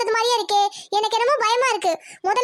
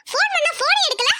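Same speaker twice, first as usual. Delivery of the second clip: scolding; 6 semitones higher than usual. Acted. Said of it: "ஏம்மா இப்படி கத்துறீங்க?".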